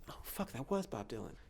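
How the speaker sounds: re-enacted quiet dismay; hushed realization. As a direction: drop the volume as it dawns on you. Retold stunned realization.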